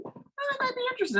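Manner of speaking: very high coy mimic; mock coy interest. Mimicking Kyra's sudden interest, very high and coy. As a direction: squeak it out, mock-flirty. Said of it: "she's like".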